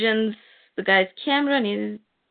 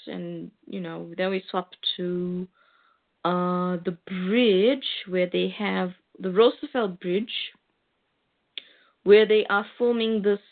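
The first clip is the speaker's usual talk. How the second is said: normal talking voice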